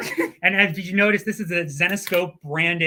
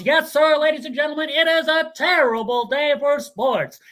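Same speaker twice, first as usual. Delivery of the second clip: higher, louder nasal sportscaster of the thirties; old-timey radio voice; 8 semitones higher, 3 dB louder than usual. Shown as a demonstration. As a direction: announce it like a vintage radio broadcast. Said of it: "old-timey radio voice".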